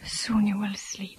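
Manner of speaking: slow soft whisper; slow tender whisper by the bedside; lulling whisper. Acted. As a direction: lull him gently toward sleep. Lulling Michael to sleep.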